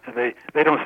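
ordinary delivery

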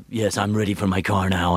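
low announcer voice for small talk; imitation of the deep-voiced trailer man; trailer guy off duty. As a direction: order a car in a deep trailer voice. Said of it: "You walk around, you go".

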